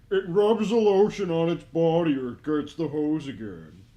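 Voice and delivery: loud mock Buffalo Bill threat; Buffalo Bill shout. Quoting the movie killer to his friend, loud and menacing. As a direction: bark the line like the killer in the pit.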